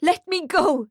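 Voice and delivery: high, desperate yell. A narrator's reading. Mother held back from the flames, yelling. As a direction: fight to break free. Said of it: "she yells".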